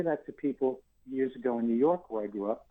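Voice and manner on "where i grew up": ordinary talk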